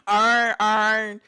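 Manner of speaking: loud, slow Baltimore "Iron" as "arn"; Baltimore accent. Shown as a demonstration. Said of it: "like the Baltimore people".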